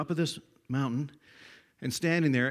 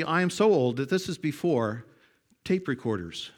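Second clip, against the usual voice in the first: ordinary talk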